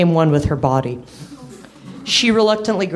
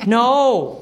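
insistent puppy; 6 semitones higher than usual. Acted. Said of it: "Copper insisted".

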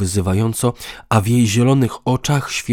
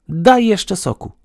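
asking woman; 12 semitones higher, 6 dB louder than usual. A narrator's reading. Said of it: "poprosiła".

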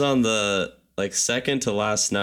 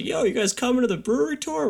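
high-pitched voice of a tour guide phoning; put-on high phone-call voice; imitated caller. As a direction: play the caller checking in, pitched way up. Acts out tour staff phoning them.

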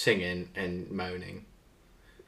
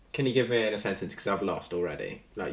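ordinary talk, nothing marked

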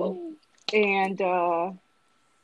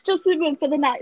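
higher, hammed-up begging; mock pleading; 8 semitones higher, 4 dB louder, faster than usual. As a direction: echo the pitiful request playfully.

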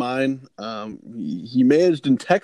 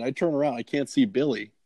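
normal talking voice

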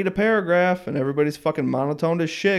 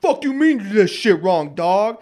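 irritated friend; 5 semitones higher than usual. Retold or imitated. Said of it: "And he's like".

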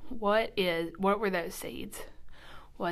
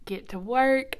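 ordinary delivery

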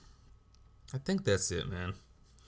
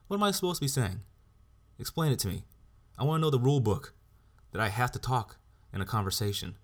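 heated raised-voice comeback; angry retort; 5 semitones higher than usual. Retold or imitated. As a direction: demand answers in fury. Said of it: "So I asked him, like".

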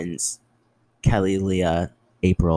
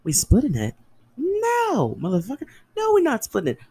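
outraged retort. Retold or imitated. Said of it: "And I'm like".

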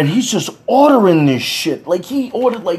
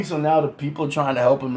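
normal talking voice

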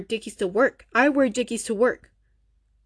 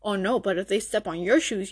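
ordinary talk

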